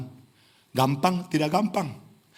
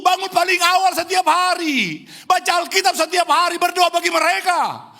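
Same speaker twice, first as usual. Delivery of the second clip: much higher and louder, passionate; climactic preaching; 14 semitones higher, 4 dB louder than usual. Real, not acted. Preacher testifying to the congregation, at full force. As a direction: build to a fervent cry.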